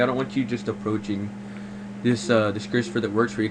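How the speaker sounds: usual speaking voice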